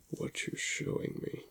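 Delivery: ordinary delivery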